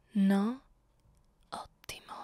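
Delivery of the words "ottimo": satisfied whisper; a small whispered approval. Acted. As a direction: breathe it out, pleased. Mistress pleased by silence.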